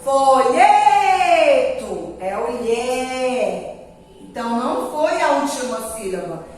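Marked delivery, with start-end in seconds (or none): 0.0-2.2 "folheto" with LHE held long and loud; drawn-out stressed LHE in "folheto". Shown as a demonstration. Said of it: "a sílaba forte dele é". 2.6-3.7 long drawn-out "lhe"; stretched "lhe" to show the strong syllable; stressed syllable held. Shown as a demonstration. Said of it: "a sílaba forte dele é".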